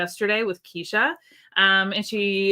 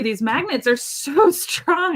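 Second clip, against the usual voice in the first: laughing; 6 semitones higher, slower than usual. Real, not acted. Laughs at magnets snapping together.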